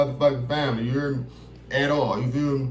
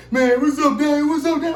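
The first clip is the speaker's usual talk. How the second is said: high, loud crackhead greeting; crackhead uncle; 11 semitones higher, 4 dB louder than usual. Retold or imitated. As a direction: call out like a begging crackhead uncle. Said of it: "He would be like".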